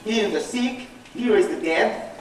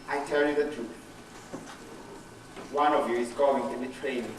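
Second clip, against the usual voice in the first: low, softer, grave pronouncement; solemn quoted Master; 10 semitones lower, 10 dB quieter than usual. Acted. The Lord tells his disciples of betrayal, solemn and low.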